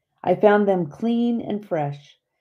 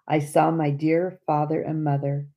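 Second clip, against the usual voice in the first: ordinary delivery, nothing marked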